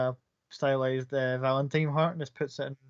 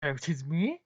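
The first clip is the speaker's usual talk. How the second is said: mock offence; 2 semitones higher than usual. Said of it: "almost feigning in the arch".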